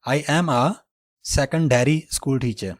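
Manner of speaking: very slow, "secondary" as "sek-un-dairy"; slow American example. Shown as a demonstration. Teacher reads American example slowly. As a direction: read it slowly, American style. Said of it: "American English को follow करना है".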